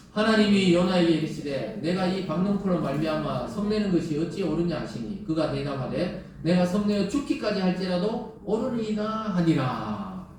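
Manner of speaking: loud, solemn Bible reading; scripture reading. Acted. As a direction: proclaim the verse to the congregation. Reads the Jonah verse aloud.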